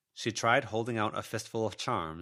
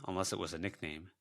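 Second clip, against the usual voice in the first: ordinary delivery, nothing marked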